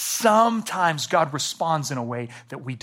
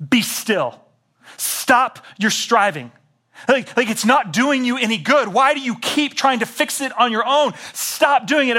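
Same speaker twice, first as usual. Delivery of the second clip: loud and higher, insistent; emphatic command; 8 semitones higher, 6 dB louder than usual. Retold or imitated. God confronting a striving believer, loud and emphatic. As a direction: bark at them to stop striving. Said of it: "much more emphatic than the caring father".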